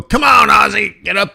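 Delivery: egging it on loudly; playful urging shout. Pretend. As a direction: shout it like urging on your team. Cheering on the Aussie dollar.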